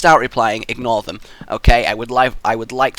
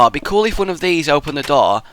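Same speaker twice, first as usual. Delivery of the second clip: usual speaking voice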